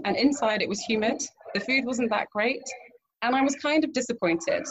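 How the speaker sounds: ordinary talk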